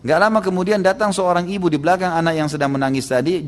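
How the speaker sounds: ordinary delivery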